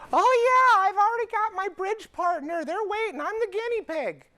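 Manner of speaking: high, loud, put-on voice of an eager patient. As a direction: chirp it like a keen old lady. Voices eager older patient Mrs Smith.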